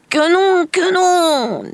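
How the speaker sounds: very high, loud wailed refusal; anguished woman. Acted. Woman protests at the happy news, wailing. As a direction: wail it, the last word drawn out and falling.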